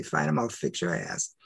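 low, quiet scheming threat; muttering payback to the doctor in a low voice; lightly voiced evil side. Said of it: "The evil part comes back out".

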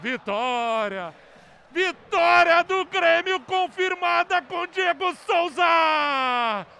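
long screamed goal call; explosive goal commentary. Real, not acted. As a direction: scream the goal with all you have.

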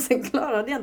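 giggling at her own failure; laughing. Real, not acted. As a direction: laugh through the admission.

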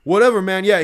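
dismissive. Retold or imitated. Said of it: "he's like".

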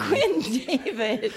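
slow, laughing correction. Real, not acted. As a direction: drag the name out laughing. Mock correction of name.

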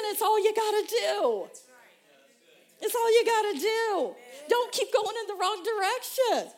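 high, loud, slow shouting exhortation; preaching at full force. Real, not acted. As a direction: shout it to the congregation with tears. Preaching climax.

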